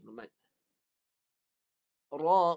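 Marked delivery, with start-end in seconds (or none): 2.1-2.6 Rauf recited with wa and fa stopped; teacher chants Rauf with the fa held still; recited demo. Shown as a demonstration. Teacher showing students a dead fa, recited. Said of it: "fa mati, wa pun mati".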